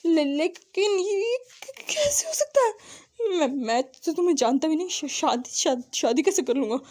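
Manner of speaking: shaky whisper rising to a high cry; a stunned young man stammering in disbelief; stammering shock. Acted. Wang ji stammers in shock at his husband's claim.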